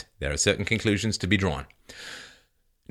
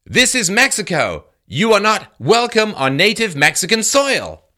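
mock protester sign; 10 semitones higher, 4 dB louder than usual. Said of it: "held a sign declaring".

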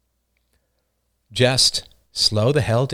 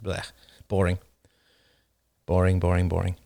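bored dismissal; 5 semitones lower, 5 dB quieter than usual. Real, not acted. Dismissing the questioners as boring.

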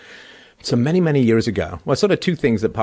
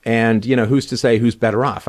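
ordinary talk

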